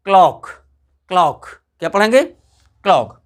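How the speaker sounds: क्लॉक with an open short o, low pitched; short o sound. Shown as a demonstration. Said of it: "आ का मतलब ओ". (क्लॉक को खुली छोटी ओ से)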